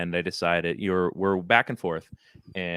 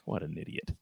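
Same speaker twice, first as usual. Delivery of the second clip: retold under-the-breath remark, lower and much softer; muttered amusement; 4 semitones lower, 12 dB quieter than usual. Dad to himself while driving his injured son, muttered amusement. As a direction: imitate dad's low, very soft aside. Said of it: "chuckling behind his breath".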